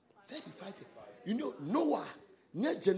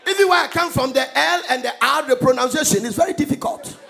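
very loud, indignant preacher's rant; full-force comic tirade; 6 semitones higher, 14 dB louder than usual. Real, not acted. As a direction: belt out a protest to the congregation. Loud mock-indignant tirade to congregation.

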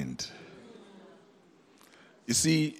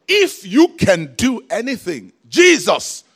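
high, loud, slow cry to Jesus; pleading shout of a father for his son; desperate plea; 9 semitones higher, 11 dB louder, slower than usual. Retold or imitated. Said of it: "the man met Jesus and said".

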